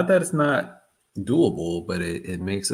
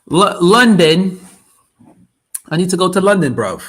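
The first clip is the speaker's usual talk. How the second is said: loud, higher, hammed-up half-London accent; mock London accent; 5 semitones higher, 6 dB louder than usual. Streamer joking he'll move to London, mock London accent. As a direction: play a cheeky Londoner wanting London, bruv. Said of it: "half London impersonation".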